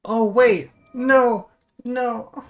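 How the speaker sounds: loud, high cries of dismay; anguished cries. Real, not acted. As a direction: yell no at the screen. Opponent turns dragon into dog.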